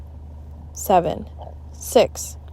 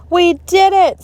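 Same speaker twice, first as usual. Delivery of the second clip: excited cheer; 11 semitones higher, 9 dB louder than usual. A narrator's reading. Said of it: "said Ryan".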